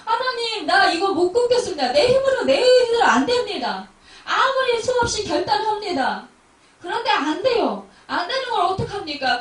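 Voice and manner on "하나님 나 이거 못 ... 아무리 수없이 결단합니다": loud, high pleading prayer with a catch in the voice; desperate prayer. Shown as a demonstration. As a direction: cry out to God for help, loud and high. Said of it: "이렇게 기도해야 되는 거예요". (하나님께 높고 크게 울부짖는 기도)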